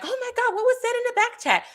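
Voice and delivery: high, fast mock gasp of shock; high breathless oh my God. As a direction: squeal it like gossip just broke. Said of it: "for those that's like".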